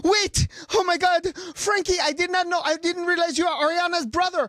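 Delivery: high, loud, breathless-paced imitation of a gushing star; excited gushing. Star meets fan's idol's brother, gushing excitedly. Acting out star's gushing reaction.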